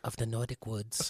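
put-on playful Scandinavian accent, storyteller style. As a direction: Scandinavian accent, ending the fairy tale line. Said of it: "in a Scandinavian accent for authenticity".

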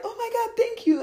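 high-pitched gushing thanks; delighted gratitude. Acted. Woman thanking guy friend for paid cruise, delighted.

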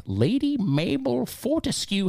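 high, slow grand announcement; posh lady voice. Acted. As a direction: announce her name with grandeur. Grand name read out.

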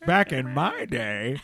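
put-on low, slow crotchety old lady. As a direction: drawl it like a grumpy granny. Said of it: "soundbite of, of an old lady saying".

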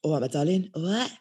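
lightly imitated breathy London girl; breathy London accent. Imitating her favourite London accent, breathy and cooing. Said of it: "Those sort of girls with the breathy London voices".

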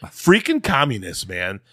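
loud, high, indignant rant; worked-up mad. Real, not acted. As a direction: rant with rising outrage. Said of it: "I was so mad".

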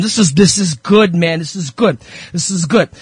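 usual speaking voice

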